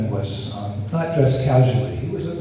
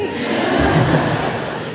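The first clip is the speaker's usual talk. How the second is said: laugh; 10 semitones higher than usual. Retold or imitated. Embarrassed man after sobbing hug.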